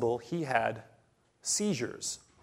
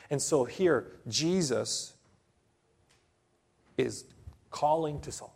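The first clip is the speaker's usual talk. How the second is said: normal talking voice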